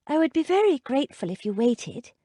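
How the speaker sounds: put-on polite young gentleman; male character voice. A narrator's reading. Zachary's voice in story.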